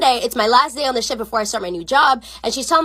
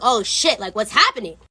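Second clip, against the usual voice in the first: plain reading voice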